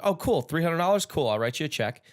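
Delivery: high, bright-pitched fake enthusiasm; mock-cheerful offer. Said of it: "I'm like".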